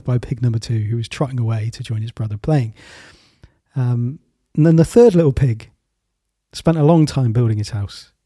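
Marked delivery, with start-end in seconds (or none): none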